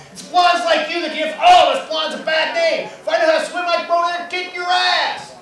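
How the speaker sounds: high, loud yell across a field; indignant shout. Acted. Blonde yelling at a rower across a field, indignant shout. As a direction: holler it in comic outrage.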